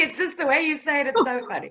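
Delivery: giggling through the words; laughing while talking. Real, not acted. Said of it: "Sorry that I'm laughing".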